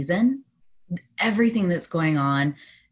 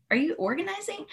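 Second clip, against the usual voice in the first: a cashier's question, pitched high and hushed; gently curious clerk, lifted high and soft; mimicking the sweet Target cashier, high and quiet; high, soft mimicked cashier; 12 semitones higher, 5 dB quieter than usual. Cashier asks her, mimicked.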